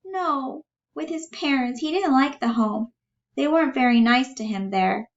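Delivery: high voice, defending him gently; earnest Bella. Acted. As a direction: explain it high and simply. Reader voices Bella explaining.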